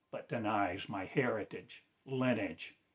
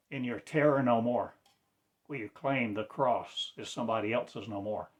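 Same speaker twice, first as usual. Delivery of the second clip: normal talking voice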